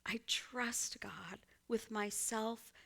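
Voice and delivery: soft, slow whispered confession; hushed sincere whisper. Real, not acted. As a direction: breathe it like a prayer. Confessing trust in God.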